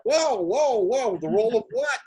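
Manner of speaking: high, loud astonished whoa; stunned disbelief. Retold or imitated. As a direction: reel back in amazement at the offer. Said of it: "I'm like".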